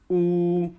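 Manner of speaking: ऊ held long; long vowel demo. Shown as a demonstration. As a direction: draw out the long ऊ. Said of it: "बड़ा ऊ". (बड़ा ऊ, लंबा खींचकर)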